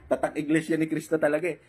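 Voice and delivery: said through a laugh; laughing remark. Real, not acted. Jabbing at the challenger's church, said through a laugh. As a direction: laugh while mocking them.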